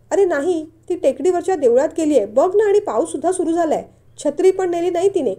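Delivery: put-on urgent answer about the girl; worried aunt. (काळजीने मोठ्याने बोलणे)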